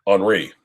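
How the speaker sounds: "Henri" said the French way, "on-REE"; French silent H. Shown as a demonstration. Offering the co-host an example, French silent-H pronunciation. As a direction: drop the H in "Henri", French vowels. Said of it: "So the H is silent".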